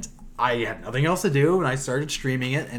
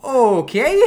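awkward okay; 11 semitones higher, 6 dB louder than usual. Retold or imitated. Stunned reply to kid.

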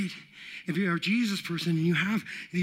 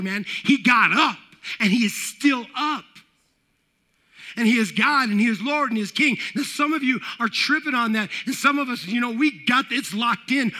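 loud, raised-pitch proclamation; forceful preaching; 3 semitones higher, 5 dB louder than usual. Real, not acted. Preacher telling congregation Jesus got up, forceful proclamation. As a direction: preach it out with rising conviction.

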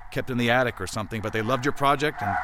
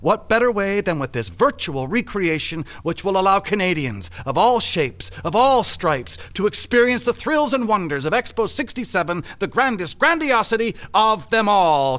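grandiose speech; 4 semitones higher, 4 dB louder than usual. Acted. Official pitching the Expo project to colleague, grandiose oratory. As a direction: sell it like a stump speech.

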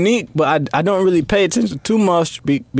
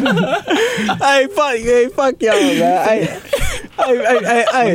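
put-on hyped drunk shouting; 6 semitones higher, much slower than usual. Hyping up, playing a drunk friend.

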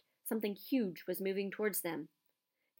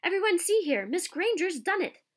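excited call; 10 semitones higher, 8 dB louder than usual. A narrator's reading. Flitwick calling the class.

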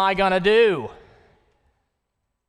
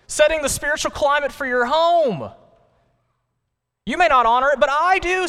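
God calling the husbands, building higher and louder; 9 semitones higher, 5 dB louder than usual. Acted. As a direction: preach it as God's summons to husbands. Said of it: "says God".

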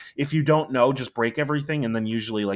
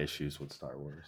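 soft, lower whispered remark; whispered aside; 8 semitones lower, 17 dB quieter than usual. Real, not acted. Gamer friend whispering about Star Wars issues, deadpan. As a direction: breathe it like a confession.

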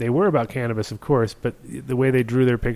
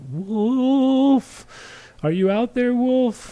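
high, drawn-out slow howl; howling like a wolf; 13 semitones higher, much slower than usual. Pretend. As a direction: howl his name like a wolf. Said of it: "You have to call him like a wolf".

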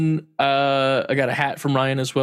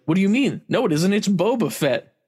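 imitating protesting mom, higher; 7 semitones higher than usual.

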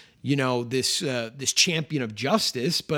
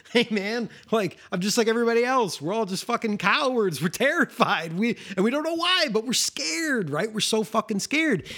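higher, fast, jittery imitation of a frightened lawyer; mock scared attorney; 7 semitones higher than usual. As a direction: play a panicky coward owning up to his fear. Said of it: "He's just basically like".

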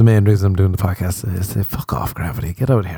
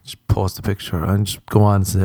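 ordinary talk, nothing marked

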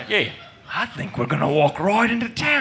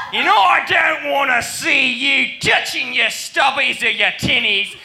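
put-on Australian accent; 6 semitones higher than usual. Mock Aussie accent to the mob. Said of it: "this mob of Aussies".

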